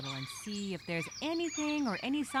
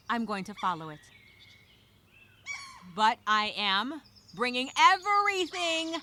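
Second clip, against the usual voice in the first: ordinary talk, nothing marked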